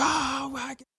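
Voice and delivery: very high, soft mock wail; mock horrified wail. Man bleeding from the head, high muted mock wail. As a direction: imitate a distant-sounding high wail.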